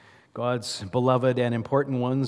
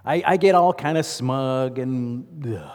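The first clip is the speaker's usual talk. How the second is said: usual speaking voice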